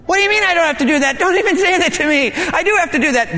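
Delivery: fast, high, defensive retort; indignant protest. Retold or imitated. Preacher voices himself arguing back at God, fast and high. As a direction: snap back, talking quickly and up high.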